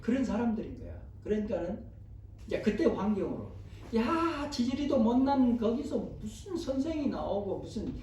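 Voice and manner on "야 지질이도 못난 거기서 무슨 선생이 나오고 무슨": higher-pitched mock scorn; sarcastic disdain. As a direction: sneer at the hopeless backwater, pitched up. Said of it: "굉장히 빈정대는 말이고". (비꼬듯 높은 목소리로)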